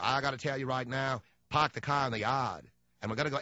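a hint of bad Boston Kennedy; Costner's Kennedy accent. Mocking Costner playing Kennedy, light Boston accent. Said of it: "he's doing Kennedy".

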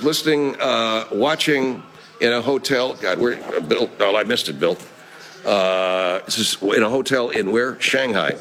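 ordinary talk, nothing marked

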